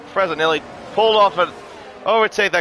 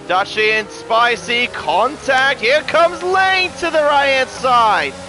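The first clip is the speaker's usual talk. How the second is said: excited commentary; 7 semitones higher than usual. Real, not acted. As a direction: build the hype through the double overtake. Live overtake, commentator excitement.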